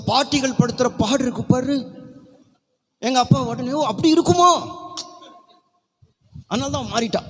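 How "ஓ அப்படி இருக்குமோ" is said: high, louder mock-surprised question; mimicked father's reaction. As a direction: act out dad suddenly wondering, pitched way up. Acts father's reply to grandmother.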